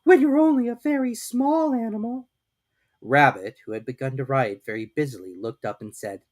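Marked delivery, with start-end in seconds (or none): 0.0-2.3 much higher, louder tearful-ish Piglet; small piglet close to sniffles; timid piglet sniffing. A narrator's reading. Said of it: "sniffing slightly".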